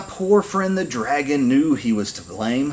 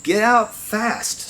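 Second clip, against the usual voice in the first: high, slow warning; piercing alarm; 10 semitones higher, slower than usual. A narrator's reading. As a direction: sound the alarm in a high, drawn-out voice. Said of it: "What a loud, piercing sound".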